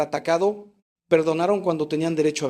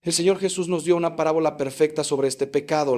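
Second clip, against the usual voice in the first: ordinary talk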